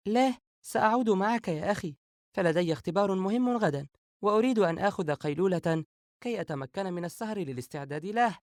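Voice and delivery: put-on high-pitched girl's voice, excusing herself; younger sister. A narrator's reading. Narrator voices younger sister Sara.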